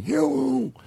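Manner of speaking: mock old guy greeting. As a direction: croak out a hello like an old man. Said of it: "my joke about the old guy voice".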